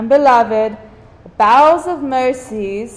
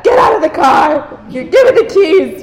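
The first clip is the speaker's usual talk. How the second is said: loud, higher mock outburst; impatient dad; 5 semitones higher, 5 dB louder than usual. Impatient dad ordering his daughter out of the car. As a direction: bark it like a fed-up dad. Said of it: "easily be like my dad".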